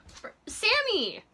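loud, high, drawn-out startled cry; startled shout. Real, not acted. Owner startled by her jumpy lizard, loud cry. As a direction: shout his name as he bolts.

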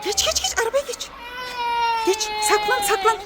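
high, hurried hushed urging; mock panic, telling a child to hide; urgent pretend hiding. Hiding from pretend Iron Man.

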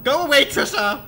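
loud yell telling her off; real angry yell at Trisha. Real, not acted. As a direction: shout her away. Yelling at game monster.

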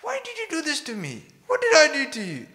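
high-pitched, upset questioning; worried, angry questioning. Acted. Worried sailors confronting Jonah, high angry questioning. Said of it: "Everyone worried and angry, asking questions".

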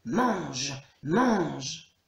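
lightly growled demon chant; a hint of monster grumble; growling demons. A narrator's reading. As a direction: growl it idly, like waiting demons. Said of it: "claquant la mâchoire et grognant".

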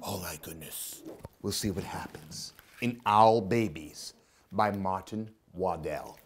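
ordinary delivery, nothing marked

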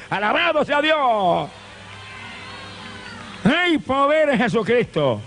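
high, slow, crying out praise; preaching shout. Acted. Preacher cries out praise to the congregation, full force.

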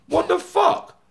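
very high-pitched startled exclamation; retold alarm. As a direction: voice leaps up in disbelief. Startled by man with pan.

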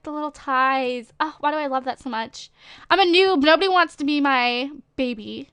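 ordinary talk

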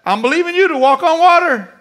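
high, loud whining plea to Jesus; hammed-up believer pleading from the boat; mocking a believer who won't step out; mock Peter crying out. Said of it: "Well, you're not walking on water".

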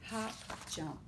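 plain reading voice

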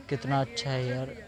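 usual speaking voice

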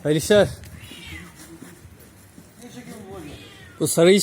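loud and high over the meowing; loud and high, leaving the cats behind; calling out loud. Real, not acted. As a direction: raise your voice above the crying cats.

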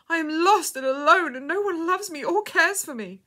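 high, loud despair; anguished cry. A narrator's reading. Abandoned girl confiding in a stranger, crying out despairingly. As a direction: wail it as one all alone. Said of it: "cried the girl".

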